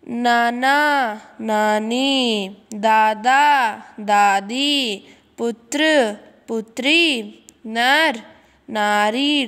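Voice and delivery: very slow, each word drawn out; slow, deliberate classroom drill; slow teaching drill. Shown as a demonstration. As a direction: spell the word pairs out slowly for the class. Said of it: "हम वहाँ उनको ई करेंगे".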